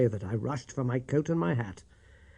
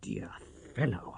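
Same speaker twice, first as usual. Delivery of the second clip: hushed plea; 10 dB quieter than usual. A narrator's reading. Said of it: "I cried".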